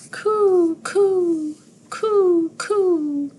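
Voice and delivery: high, loud dove cooing. A narrator's reading. Teacher reading to class, cooing like a dove. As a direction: coo like a morning dove. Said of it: "Morning doves take their morning stroll".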